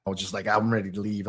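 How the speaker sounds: ordinary delivery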